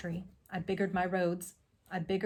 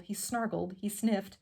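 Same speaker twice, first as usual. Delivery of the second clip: ordinary delivery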